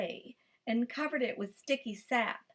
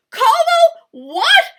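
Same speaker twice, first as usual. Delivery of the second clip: much higher, louder outburst; shocked cry; 17 dB louder, slower than usual. A narrator's reading. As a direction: explode in disbelief. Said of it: "cried the farmer".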